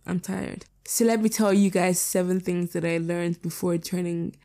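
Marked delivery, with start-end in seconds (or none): 0.1-0.7 soft, worn-out tired voice. Real, not acted. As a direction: let it sag with tiredness. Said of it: "I've been recording for way too long".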